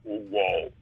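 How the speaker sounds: very low mimicked synth "wow"; deep keyboard sample impression. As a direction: play the sample on a low key. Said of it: "And he just turned the".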